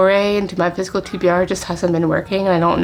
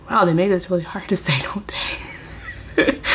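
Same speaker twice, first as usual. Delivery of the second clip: quick whispered aside; 4 dB quieter than usual. Real, not acted. Vlogger to viewers stumbling over the blurb names, whispered aside. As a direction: drop to a whisper for a private comment.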